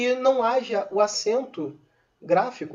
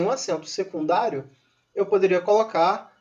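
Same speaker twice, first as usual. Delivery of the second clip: ordinary talk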